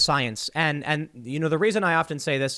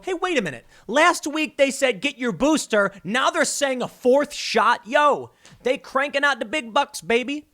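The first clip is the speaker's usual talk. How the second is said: mocking regular guy; 8 semitones higher than usual. Voicing regular people catching on.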